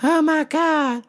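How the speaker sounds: put-on a new girl frozen in panic; flustered panic. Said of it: "she freezes up".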